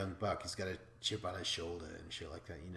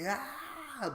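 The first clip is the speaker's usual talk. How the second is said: very high mock strained cry; hammed-up straining yell; scream. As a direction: imitate summoning all his strength.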